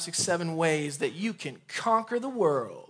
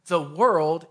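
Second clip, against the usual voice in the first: world said louder and held; stress on world; 4 dB louder than usual. Shown as a demonstration. Preacher stressing world to the congregation. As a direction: lean on the word world. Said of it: "emphasis on the word world".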